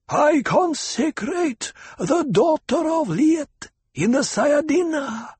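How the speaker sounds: high, slow, husky crone voice; husky old woman. A narrator's reading. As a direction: intone it like an ancient priestess. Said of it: "husked the old woman".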